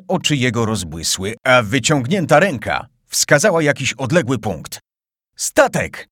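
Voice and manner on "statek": a sharp raised cry of "Statek!"; called out. A narrator's reading. Glenarvan calls to his companions, a raised cry. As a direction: call out spotting a ship. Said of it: "zawołał".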